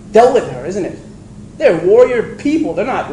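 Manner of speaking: ordinary talk, nothing marked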